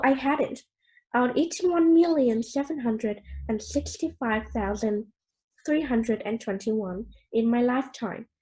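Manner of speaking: usual speaking voice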